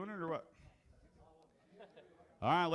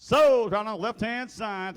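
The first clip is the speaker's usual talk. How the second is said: loud, high, drawn-out closing cry; auctioneer's sold call; 9 semitones higher, 17 dB louder, slower than usual. Real, not acted. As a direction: call the sale out across the room. Auctioneer calls out the sale.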